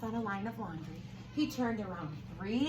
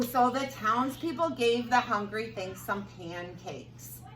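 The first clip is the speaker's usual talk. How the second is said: plain reading voice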